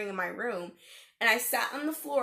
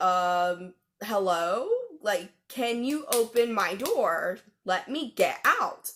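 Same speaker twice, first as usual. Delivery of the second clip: louder, exasperated plea; slowed, emphatic demand to be heard; insistent calling out; 5 dB louder, slower than usual. Retold or imitated. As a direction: call through a shut door, stretching the words. Retold plea at jammed door.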